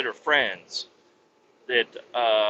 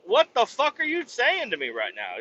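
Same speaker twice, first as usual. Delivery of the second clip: pitched-up outraged question; stunned and offended, higher; incredulous; 9 semitones higher than usual. Retold or imitated. Answering the cop's tampering ruling, in high outraged disbelief. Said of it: "I'm like".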